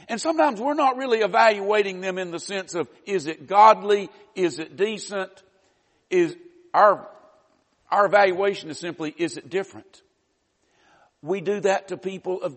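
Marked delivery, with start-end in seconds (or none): none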